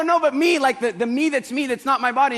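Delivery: insistent. Retold or imitated. Insisting to the chief about the real self, high and pleading. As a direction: plead urgently.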